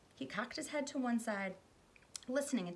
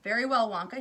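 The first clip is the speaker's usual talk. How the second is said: important; 3 semitones higher, 11 dB louder than usual. Acted. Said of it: "he said importantly".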